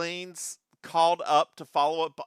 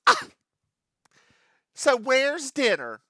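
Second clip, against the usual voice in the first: Charlene's voice; 9 semitones higher, 4 dB louder than usual. Retold or imitated. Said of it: "So Charlene's like".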